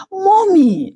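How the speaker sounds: very high, drawn-out cry; screamed plea. A narrator's reading. Betrayed daughter to her lying mother, screaming. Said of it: "I screamed".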